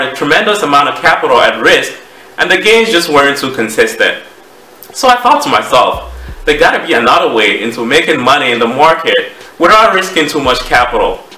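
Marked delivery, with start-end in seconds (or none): none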